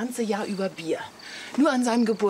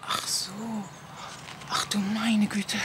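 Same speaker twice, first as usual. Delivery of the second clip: whisper. Acted. Wife whispering in dismay, caught out by her husband. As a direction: gasp it out under your breath.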